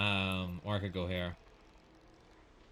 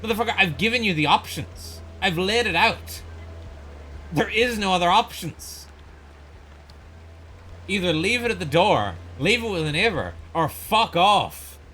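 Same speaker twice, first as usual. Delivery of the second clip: retold furious telling-off; 7 semitones higher, 4 dB louder than usual. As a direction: lay down the law to the courier, fuming. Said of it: "I'm like".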